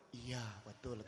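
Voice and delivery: very low, soft reply; quiet mock-meek voice; sheepish admission. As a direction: act out a small, subdued yes. Sheepish man admits it.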